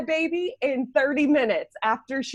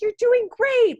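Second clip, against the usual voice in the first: high, loud, sugary encouragement; cutesy nurse; 12 semitones higher, 5 dB louder than usual. Retold or imitated. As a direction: imitate an over-sweet cheering nurse. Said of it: "like, super cutesy".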